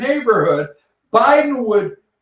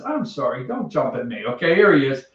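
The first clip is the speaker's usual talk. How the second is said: ordinary delivery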